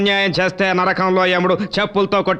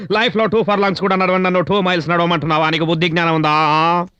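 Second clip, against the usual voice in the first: ordinary talk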